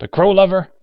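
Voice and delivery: high, loud scornful jab; snapped. Acted. Old man taunts the woman, snapping. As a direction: hurl it as an angry insult. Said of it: "Unger snapped".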